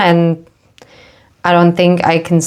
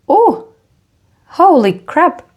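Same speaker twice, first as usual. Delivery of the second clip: sudden high gasp, then a slow holy crap; startled exclamation; 11 semitones higher, slower than usual. Real, not acted. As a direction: caught off guard, voice jumping up. Sudden shock at the card.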